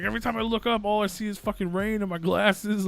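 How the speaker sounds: high, loud mock distress; overwhelmed complaint, acted in a panic; freaked-out prank caller. Answering whether he sees light, freaking out. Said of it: "I'm freaking out".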